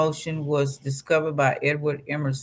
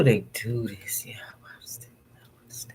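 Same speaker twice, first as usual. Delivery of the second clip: breathy whispered aside, barely there; 5 semitones lower, 6 dB quieter than usual. Real, not acted. Whispering to her friend about his behaviour. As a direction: drop to a whisper while commiserating.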